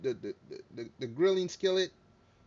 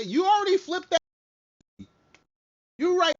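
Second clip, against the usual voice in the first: shrill scolding burst, much higher and louder; sudden high yell; 13 semitones higher, 8 dB louder than usual. Real, not acted. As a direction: yelp it out at the cat.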